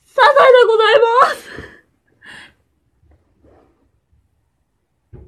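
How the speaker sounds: high, loud cartoon housewife catchphrase; Sazae-san voice. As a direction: play the cartoon mother brightly. (サザエさんのモノマネ)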